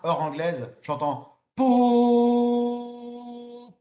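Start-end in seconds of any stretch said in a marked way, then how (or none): 1.6-3.7 loud high foghorn imitation; foghorn blast. [name] sounds the horn, loud foghorn imitation.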